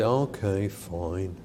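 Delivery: put-on low, grudging kid's voice; sulky kid. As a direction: mutter it like a sulky child giving in. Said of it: "And they say".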